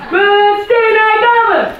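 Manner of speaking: high, loud angry yell; dad sends Peter to his room, high and loud. Acted. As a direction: bellow him off to his room. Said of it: "gilde papa".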